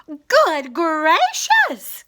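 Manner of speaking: slow, breathless gasp of surprise; startled bird gasping; gasped exclamation. Acted. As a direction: gasp it out, stunned. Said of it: "gasped Maisie".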